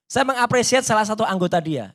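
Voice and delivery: ordinary delivery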